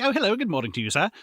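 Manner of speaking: lightly hammed-up prim British voice; mock proper English. Mocking Southerners sounding British, mock posh English. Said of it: "We might as well be like".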